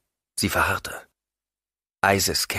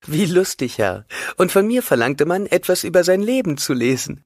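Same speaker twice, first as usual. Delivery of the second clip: higher voice, amused and a little louder; amused Hwi; 12 semitones higher, 5 dB louder than usual. Acted. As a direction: let Hwi's amusement show.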